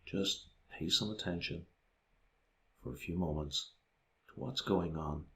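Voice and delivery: soft, hushed meditation voice with long pauses; guided meditation. Acted. Guiding listeners through a body scan of the feet, hushed meditation voice. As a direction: guide attention softly, leaving space between phrases.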